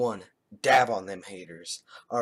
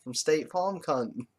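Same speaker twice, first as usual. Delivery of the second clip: mocking Australian; 3 semitones higher, slower than usual. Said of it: "and it was like".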